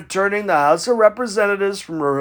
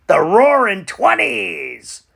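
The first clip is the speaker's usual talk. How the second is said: slow grand announce; slower than usual. Acted. Punchline on darkest decade.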